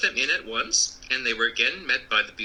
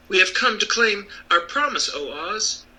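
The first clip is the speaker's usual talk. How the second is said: put-on young Dorothy; 8 semitones higher than usual. A narrator's reading. Dorothy addresses the throne.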